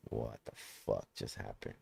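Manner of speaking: low, soft, awestruck murmur; dazed disbelief, almost under the breath; hushed and low, reeling in wonder; stunned hush. Retold or imitated. Stunned after rebirth.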